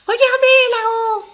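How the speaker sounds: high, loud cry of discovery; excited shout. Acted. Elephant spotting the fruit, calling out excitedly. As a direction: call up to the treetop, thrilled.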